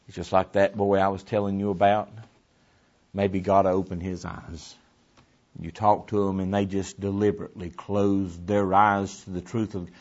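normal talking voice

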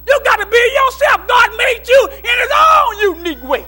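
high, loud preacher's shout; shouted preaching. Acted. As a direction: holler it to the congregation. Preacher's climax, shouting to congregation.

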